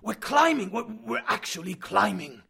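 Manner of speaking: loud and high, slowing on the repeat; stunned, almost disbelieving cry; excited shout. Acted. Uncle realises they are rising.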